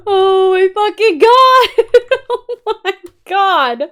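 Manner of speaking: high, slow, laughing through it; relieved laughter. Real, not acted. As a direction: laugh off the fright, drawn out. Laughing off the scare.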